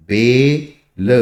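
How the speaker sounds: बेल drawn out slowly on the ए; stretched demo. Shown as a demonstration. Teacher stretching बेल to show the ए sound. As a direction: hold the ए in बेल. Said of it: "ए की sound".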